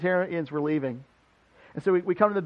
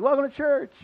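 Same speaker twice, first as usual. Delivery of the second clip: cheery greeting; 8 semitones higher than usual. Retold or imitated. Mock greeting to congregation.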